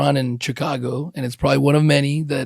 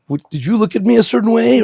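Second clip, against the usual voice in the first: higher, challenging mock accusation; confrontational; 7 semitones higher than usual. Man picking a fight over a look, higher mock challenge. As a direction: call someone out for looking at you.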